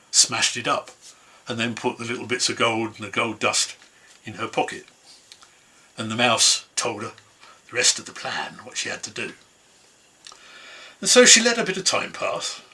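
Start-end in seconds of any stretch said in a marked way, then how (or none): none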